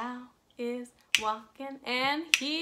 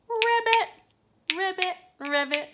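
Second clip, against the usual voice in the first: frog ribbit; 5 semitones higher, 4 dB louder, faster than usual. Shown as a demonstration. Teacher voicing the frog.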